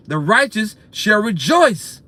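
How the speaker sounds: very slow intoned verse; slow King James recital; solemn scripture reading. Shown as a demonstration. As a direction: stretch out each word of the psalm. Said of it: "Psalms fifty-eight and ten".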